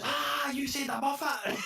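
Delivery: high, loud, drawn-out crowing shout; triumphant gotcha. Retold or imitated. Cafe man catching the customer out, triumphant high shout. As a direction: crow it slowly, far up in pitch. Sketch punchline, man caught saying it.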